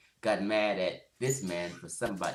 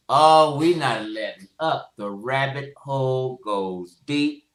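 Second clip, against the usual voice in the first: loud, slow and drawn out, higher; loud slow rant; 4 semitones higher, 11 dB louder, slower than usual. Real, not acted. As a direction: preach it slowly at full volume. Ranting at cult leaders.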